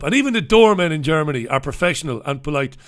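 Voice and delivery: usual speaking voice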